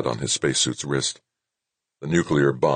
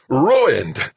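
high, loud outraged roar; raging scientist's roar; furious roar; 11 semitones higher, 6 dB louder than usual. A narrator's reading. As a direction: roar it as if all is lost. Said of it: "Zimmerman roared".

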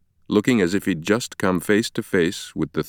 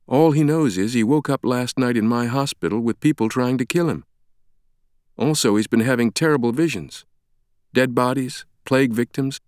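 higher-pitched, composed explanation; take-charge woman doctor; 6 semitones higher than usual. Acted. Sienna explains Langdon's amnesia to Ferris, taking charge. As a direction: step in and take control.

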